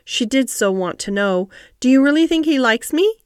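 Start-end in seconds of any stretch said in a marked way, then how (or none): 1.8-3.1 higher, eager question rising at the end; mildly excited, pitch lifted. A narrator's reading. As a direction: ask it with keen hope. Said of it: "cried Mary eagerly".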